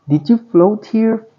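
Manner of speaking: put-on high little girl's voice asking; high, wide-eyed child's question; young girl. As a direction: pitch it up as a curious young girl. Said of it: "a girl asked".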